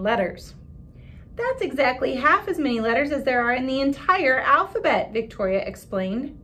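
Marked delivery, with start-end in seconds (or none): 1.4-5.1 louder, higher smug tone; know-it-all. Acted.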